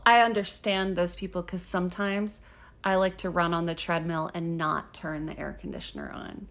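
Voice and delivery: low, softened mock sincerity; dry sarcasm, voice dropped low and quiet; sarcastic. Real, not acted. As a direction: play it falsely earnest. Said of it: "can you tell how sarcastic I'm being".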